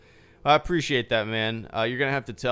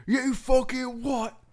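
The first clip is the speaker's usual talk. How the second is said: proper cockney yell; 13 semitones higher, 4 dB louder, slower than usual. Retold or imitated. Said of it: "try to do it superly... proper".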